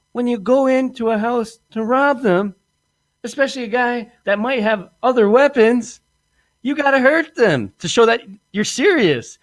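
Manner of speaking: mock tough lecture. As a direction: talk down to the rookie. Lecturing accomplice on robbery.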